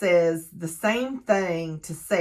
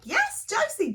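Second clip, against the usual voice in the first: proud cheer. Retold or imitated. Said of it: "I was so proud of my girl".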